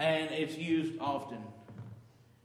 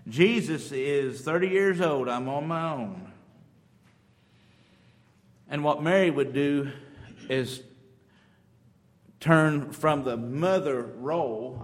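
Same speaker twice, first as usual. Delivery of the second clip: louder, slower pulpit emphasis; loud preaching; 9 dB louder than usual. Acted. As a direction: drive the point home. Preaching at full force.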